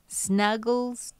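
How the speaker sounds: ordinary talk, nothing marked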